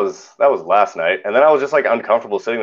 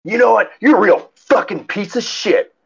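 mock angry scream; 12 semitones higher than usual. Angry lady jabs me in the shoulder, mock scream. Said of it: "starts screaming at me".

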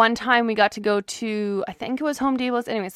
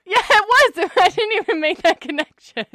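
laughing; 5 semitones higher than usual. Real, not acted. Cracking up at the joke.

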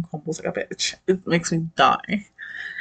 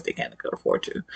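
ordinary delivery

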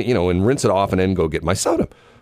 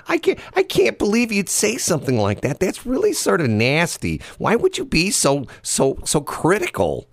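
mock hurt; 11 semitones higher than usual. Mock-hurt whining at co-host's remark.

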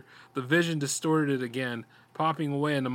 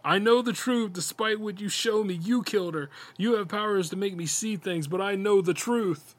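raised voice, higher, calling out into the dark; 6 semitones higher than usual. A narrator's reading. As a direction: call out defiantly, pitched up. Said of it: "Brian called out".

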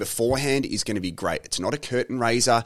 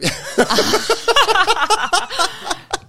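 long, high peal of laughter; big laugh; 4 dB louder than usual. Real, not acted. Host reacting to a phone message mid-interview, high laughter. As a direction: burst out laughing.

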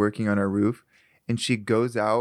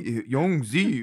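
mock douchey name; slower than usual. Mocking douchebag pronunciation.

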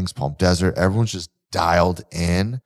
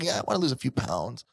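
softer, uncommitted mock client voice; mock half-hearted; 3 semitones higher, 9 dB quieter, faster than usual. Coach imitating a client not serious about change, half-hearted. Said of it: "they're not very serious about change".

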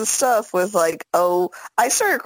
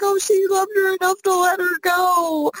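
high-pitched mock weepy wail; imitated crying; 11 semitones higher than usual. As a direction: imitate a tearful movie watcher up high. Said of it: "she will cry that exact same sentence".

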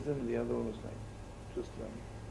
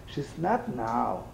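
ordinary talk

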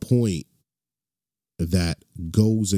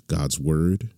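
usual speaking voice

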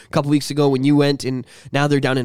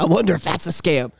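ordinary talk, nothing marked

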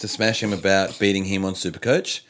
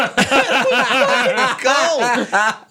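burst of laughter; 13 semitones higher, 3 dB louder than usual. Real, not acted. Laughs at the quip.

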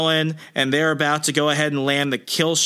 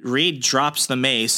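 usual speaking voice

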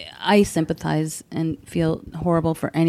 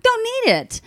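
very high, giddy brush-off; mock-manic chirp; manic dismissal. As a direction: wave the question away in a squeaky high pitch. Acting her manic self answering doctor.

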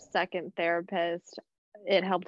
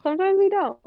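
laughing; 8 semitones higher, 4 dB louder than usual. Real, not acted. Laughing reply to friend's tease.

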